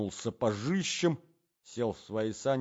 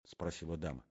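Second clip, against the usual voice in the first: ordinary delivery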